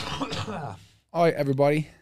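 hammed-up British coach accent, laughing through it; a playful, badly faked British manager. British manager calling the team to training, mock accent. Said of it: "I suck at doing a British accent".

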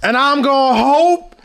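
Steve Harvey impression. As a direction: channel a smooth TV host wishing them luck. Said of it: "his Steve Harvey impression".

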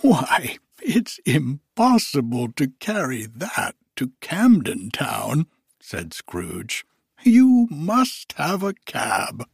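put-on high, loud, elated old miser; giddy old Scrooge. Scrooge overjoyed on Christmas morning, high giddy voice. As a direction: bubble over with joy as old Scrooge.

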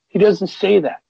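normal talking voice

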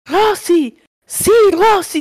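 hammed-up alarmed video guy; excited man in video. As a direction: call Rosy like a startled guy. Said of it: "de repente hace".

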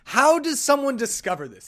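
high, loud incredulous question; baffled friend. Retold or imitated. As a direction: imitate a friend's bewildered outburst. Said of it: "and she was like".

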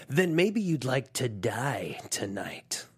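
slow, whispered threat; menacing whisper. Acted. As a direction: breathe the threat slowly. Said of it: "our action movie tagline".

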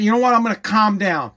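exaggerated deep-breath calm; mock calming down. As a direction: talk yourself down, sarcastically. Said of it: "I said".

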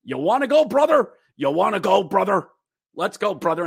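mock goading the opponent to fight; cocky fighter. Enforcer goading a Phantom into a fight, cocky taunt. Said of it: "Zane Wisdom's like".

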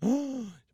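a sharp, high gasp of shock; crowd gasp. Acted. Crowd at the prayer service gasping in shock, sharp high gasp. Crowd reacting to Bobby fainting.

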